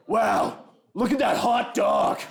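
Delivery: slow, high shout of creepy over-admiration; hammed-up, drawn-out lusty wow; lustful admirer. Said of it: "guy who's super into bestiality".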